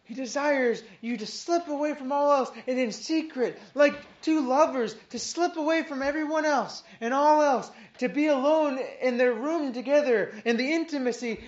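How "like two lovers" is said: ordinary delivery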